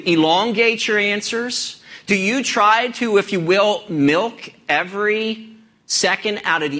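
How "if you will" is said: ordinary delivery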